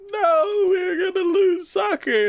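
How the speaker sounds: high, whiny mock despair; mock whine. The team answering the sponsor, mock whiny despair. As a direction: whimper like a beaten kid. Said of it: "And they're like".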